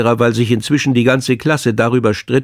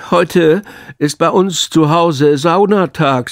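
langsame, höhere Schülerstimme voll Stolz; verkünde es stolz und gedehnt; 5 semitones higher, slower than usual. A narrator's reading. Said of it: "sagte Pekka und lächelte stolz". (stolz lächelnd)

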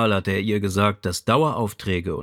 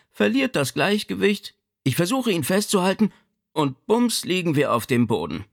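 animated, higher-pitched mother's account; mother recounting a mishap; 8 semitones higher than usual. A narrator's reading. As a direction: relive the fall as you tell it.

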